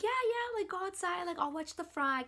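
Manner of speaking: very high, eager reassurance; imitating supportive boss. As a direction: wave someone along in a high voice. Boss eagerly sends her outside.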